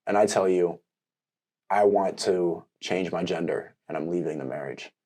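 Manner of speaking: low, soft, grave pretend announcement; pretend-earnest, hushed bombshell; mock-solemn confession. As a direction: play a serious confession, quiet and low. Husband acts serious hypothetical confession.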